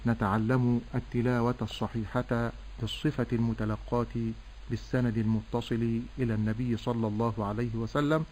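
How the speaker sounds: usual speaking voice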